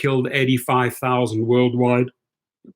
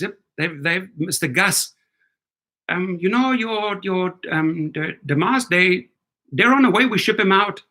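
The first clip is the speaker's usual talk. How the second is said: a hint of a put-on Indian accent, rising and halting; mock Indian accent; 5 semitones higher than usual. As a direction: play a halting Indian supplier, lightly. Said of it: "That's the Indian".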